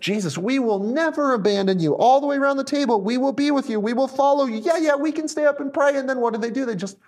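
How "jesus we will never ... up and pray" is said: high-pitched, overconfident pledges tumbling out; eager disciples. Acted. Disciples vowing to Jesus round the table, eager pledge. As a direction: promise loyalty with mock gusto. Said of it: "Just like the disciples".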